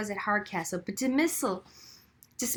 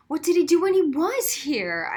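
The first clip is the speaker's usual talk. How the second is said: countering; 6 semitones higher, 6 dB louder than usual. A narrator's reading. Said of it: "I countered".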